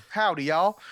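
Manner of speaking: a hammed-up Southern howdy; Southern accent try. Shown as a demonstration. Speaker trying a Southern accent for the guest, loud. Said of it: "I, I can't do it".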